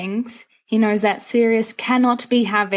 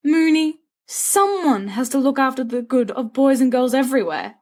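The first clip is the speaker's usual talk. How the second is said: grand mock-preacher voice, higher; mock sermon; 6 semitones higher than usual. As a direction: preach it like a self-appointed crusader. Sirius mock sermon on Valentine's.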